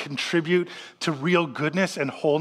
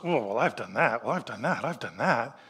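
lower, softer mock confession, a bit embarrassed; sheepish admission; 6 semitones lower, 6 dB quieter than usual. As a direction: admit it quietly like a caught congregant. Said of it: "And everybody goes".

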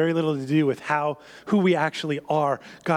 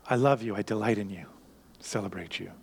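tender father; 11 semitones lower, 6 dB quieter than usual. Acted. Father interrupts son's speech.

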